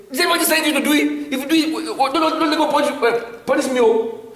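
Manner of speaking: high, fast, agitated protest to his wife; exasperated retort. Retold or imitated. Telling his wife off, high and fast in agitation. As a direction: fire back at her, worked up and rushing.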